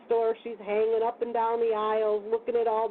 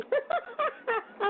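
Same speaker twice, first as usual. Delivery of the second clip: a much higher, soft laugh; real high laugh; 14 semitones higher, 4 dB quieter than usual. Real, not acted. Guest laughing about the biker boot dude, high soft laugh. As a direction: break into a giggle.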